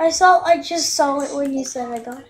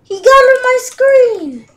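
scared horror game streamer; 7 semitones higher, 9 dB louder than usual. Real, not acted. After jump scare.

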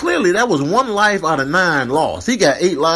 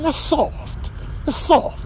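mock scoff; 13 semitones higher, slower than usual. Mocking hunters as soft.

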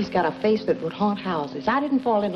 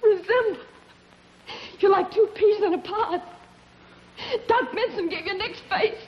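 hysterical laughter; 12 semitones higher than usual. Acted. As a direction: break into manic, tearful laughter at his new face. Sees Vince's new face, breaks down.

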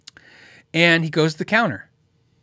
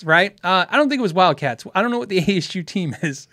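ordinary talk, nothing marked

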